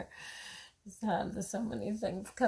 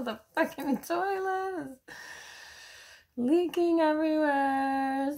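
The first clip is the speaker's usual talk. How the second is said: a touch of frustrated yelling; exasperated; 6 semitones higher, 3 dB louder than usual. Retold or imitated. Mom telling about the leaking toilet, with mild exasperation. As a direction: vent at the broken toilet. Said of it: "So I go".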